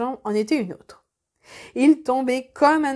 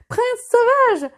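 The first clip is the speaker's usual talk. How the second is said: much higher, louder, slow astonished cry; stunned cry; 10 semitones higher, 6 dB louder, slower than usual. A narrator's reading. Old nurse recognizing the lost prince, astonished cry. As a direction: gasp out the name in disbelief. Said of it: "dit la bonne femme interdite".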